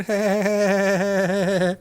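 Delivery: wheezy pained cartoon laugh; Peter Griffin laugh. Real, not acted. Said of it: "the Peter Griffin laugh".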